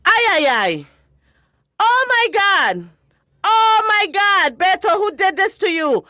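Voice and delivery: high, loud, shocked cries of a mother; a mother discovering her son was hurt, horrified. Acted. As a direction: panic rising as she sees the wound. Mother discovers son's injury.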